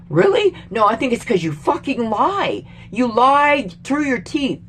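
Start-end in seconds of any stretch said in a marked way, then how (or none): 0.1-0.5 high, scoffing "Really?"; sarcastic disbelief. Real, not acted. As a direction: throw it back at them in disbelief. Sarcastic reply to officials.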